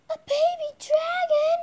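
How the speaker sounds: very high, loud squeal of delight; thrilled. Pretend. Shy pony seeing a baby dragon, thrilled squeal. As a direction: squeal with amazed excitement.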